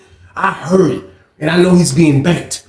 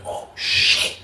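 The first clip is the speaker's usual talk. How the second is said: whispered shock; 8 dB quieter than usual. Retold or imitated. Shocked reaction.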